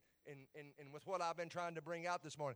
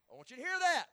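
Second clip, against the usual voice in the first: emphatic call; 8 semitones higher, 7 dB louder than usual. Real, not acted. Preacher repeats line louder later.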